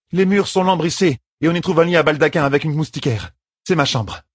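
high-pitched, urgent orders; young woman leader, commanding. A narrator's reading. Young leader sending the sisters to safety, commanding.

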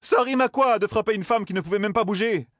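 high-pitched, outraged question; indignant roar. A narrator's reading. Matt scolds them for striking a helpless woman, indignant. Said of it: "rugit Matt".

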